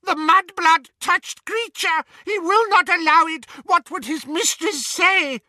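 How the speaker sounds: high, loud elf voice recoiling in disgust; repulsed house-elf. Acted. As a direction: shriek your outrage at being touched. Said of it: "quite obviously repulsed".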